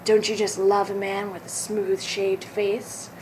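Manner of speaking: lightly voiced ad pitchman, smooth and selling; a hint of a late-night infomercial host; mock TV commercial. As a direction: pitch razors to the ladies like a cheesy ad. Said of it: "it was like".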